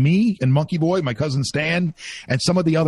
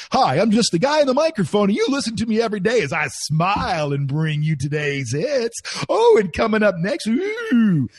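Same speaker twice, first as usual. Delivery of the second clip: high, bright-toned announcer patter, louder; cheesy radio DJ; 9 semitones higher, 3 dB louder than usual. Retold or imitated. Mocking a bland cheerful DJ with high, louder patter. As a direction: ham up the smiling DJ.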